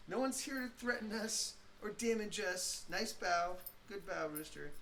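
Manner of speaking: soft, high voice calming dogs; soothing dog voice. Real, not acted. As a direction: hush and reassure a nervous dog. Calming barking dogs nearby.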